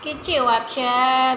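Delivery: put-on each word drawn out slowly for a toddler to copy; coaxing a little boy to greet the viewers, slow and clear; slow coaching prompt. Shown as a demonstration. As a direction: spell out कैसे हो आप सब slowly so the child can repeat it. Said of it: "prompting toddler to greet".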